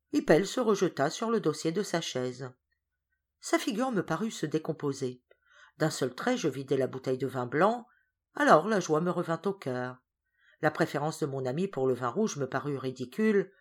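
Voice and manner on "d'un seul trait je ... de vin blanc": plain reading voice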